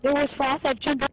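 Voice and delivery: agitated. Real, not acted. Arguing with teammates loudly.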